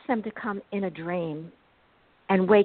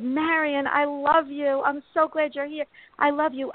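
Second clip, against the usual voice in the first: tender; 6 semitones higher than usual. Retold or imitated. Talking tearfully to the bird.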